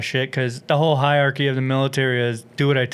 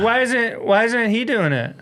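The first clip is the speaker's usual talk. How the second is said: a higher mock old lady, tattling; lightly voiced old woman ratting him out; 8 semitones higher than usual. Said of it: "he's like".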